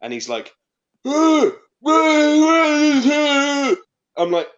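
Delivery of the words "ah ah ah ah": loud, high shouted ah sounds; raving coworker yelling over imagined club noise; shouting over loud music. Retold or imitated. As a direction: bellow like you're next to the speakers. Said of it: "talks as if he's been shouting in a loud bar".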